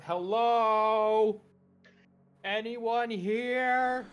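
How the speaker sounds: high, drawn-out call into the distance; loud, slow hailing call; very loud shout. Acted. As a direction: holler for anyone around. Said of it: "Kothwood just very loudly shouts".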